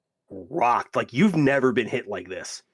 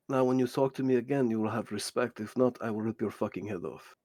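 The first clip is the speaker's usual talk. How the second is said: quiet, measured threat; lightly voiced stern menace; menacing game character; 3 semitones lower, 6 dB quieter than usual. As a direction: threaten softly, keeping it controlled. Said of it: "and he goes".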